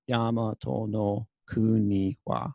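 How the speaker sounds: slow, evenly stretched syllables; drawn-out counting recitation; syllable-by-syllable demo. Shown as a demonstration. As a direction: sound out each syllable of the closing line. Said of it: "another seven-syllable phrase".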